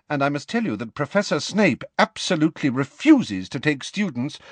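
high-pitched, emphatic careers talk; stern professor. Acted. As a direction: advise a pupil with firm authority. McGonagall advising Potter.

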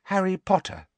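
high, careful naming; tentative. A narrator's reading. Hermione introducing Harry to the elf, tentative. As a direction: offer the name carefully, pitched high. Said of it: "said Hermione tentatively".

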